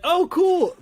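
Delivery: very high, loud squeal of excitement; overexcited. Retold or imitated. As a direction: gush like a starstruck fan. Overexcited reply to the stranger.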